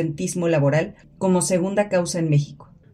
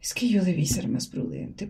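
whispered regret; 6 dB quieter than usual. Acted. Self-reproach murmured.

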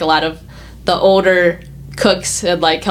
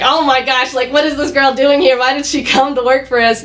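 imitating startled cooks, pitched higher; a light show of disbelief from the cooks; mock shocked cooks; 6 semitones higher than usual. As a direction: cooks aghast at the new girl, mock surprise. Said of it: "they're like".

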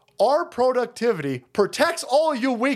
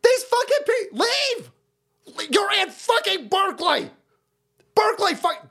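high, exasperated yelling; angry rant; 11 semitones higher than usual. Real, not acted. Host railing at Berkeley protesters, angry outburst. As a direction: yell at them to get out.

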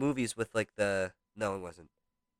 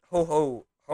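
Santa ho ho ho; 5 semitones higher, 5 dB louder than usual. Pretend. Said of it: "I thought you said I was Santa".

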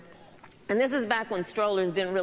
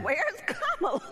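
suddenly higher, startled and laughing; alarmed uncle; 11 semitones higher than usual. Retold or imitated. [name] retelling her uncle's panic, laughing surprise. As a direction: uncle finding the stroller empty. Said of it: "looked in the stroller ... and said".

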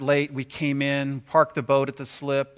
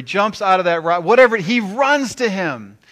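loud, high, urgent storytelling; excited retelling; 6 semitones higher, 9 dB louder than usual. A narrator's reading. Preacher tells of father rushing to son, excitedly.

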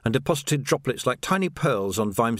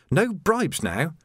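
higher, irritated landlady; snapping; 8 semitones higher than usual. A narrator's reading. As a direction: vent your frustration, clipped. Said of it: "snapped Rosie".

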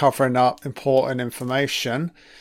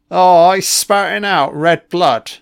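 ordinary talk, nothing marked

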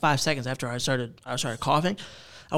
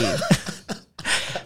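breathy laugh; 6 semitones higher than usual. Real, not acted. Laughing at the order.

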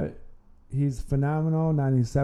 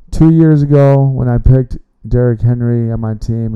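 louder and heated, "two" hit hard; loud complaint; 11 dB louder than usual. Real, not acted. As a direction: vent it loudly like a ruined fantasy season. Complains about his fantasy season.

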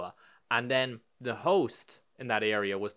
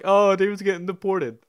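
lightly mocking, raised louder voice; teasing friends; 6 semitones higher, 7 dB louder than usual. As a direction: imitate friends teasing, high and loud.

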